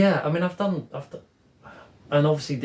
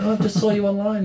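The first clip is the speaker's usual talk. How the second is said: high, loud, laughing imitation of an online fan; mock-excited greeting; 10 semitones higher, 6 dB louder, faster than usual. Artist mimics meeting someone seen online, mock excited. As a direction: play up the thrill of spotting them, laughing.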